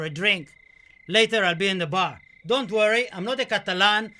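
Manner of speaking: loud, high master of ceremonies greeting the crowd; cabaret showman. Acted. Dead poet toasting the audience as a loud cabaret host. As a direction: play the dead man as a grinning show host. Addresses ladies and gents as showman.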